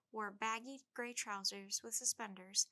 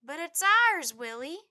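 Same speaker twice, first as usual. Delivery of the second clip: whiny protest; 7 semitones higher, 16 dB louder, slower than usual. A narrator's reading. Little brother protesting.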